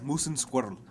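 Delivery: low Russian accent demo. Shown as a demonstration. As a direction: show how a Russian says it. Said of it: "Like this, like this".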